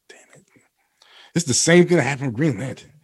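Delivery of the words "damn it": breathy sigh. Retold or imitated. Sighing disappointment at wife's question.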